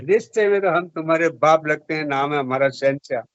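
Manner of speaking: usual speaking voice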